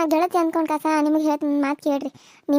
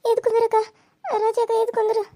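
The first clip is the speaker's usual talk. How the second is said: high, crying plea to get up; tearful plea; 6 semitones higher than usual. Acted. Niece to beaten woman on floor, crying high plea. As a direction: weep as you lift her.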